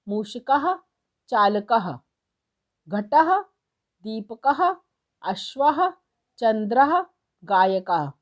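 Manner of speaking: each word's final ः voiced as a short aha, like बिडालः as bidaalaha; teacher drilling the visarga sound word by word. Shown as a demonstration. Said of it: "जिसमें अह की sound निकलती है".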